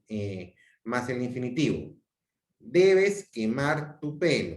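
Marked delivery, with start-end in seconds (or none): 2.6-4.6 slow, stressed command on Debes; slow firm order; imperative. Shown as a demonstration. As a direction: press Debes, then spell it out slowly. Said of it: "la conjugación del verbo deber".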